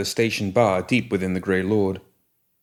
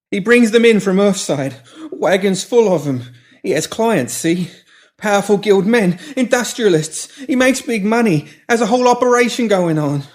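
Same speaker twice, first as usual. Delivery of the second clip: much higher, louder frantic begging; panicked pleading; 12 semitones higher, 6 dB louder than usual. Acted. Pinky pleading with the woman holding shears, panicked. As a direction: beg for your life, backing away.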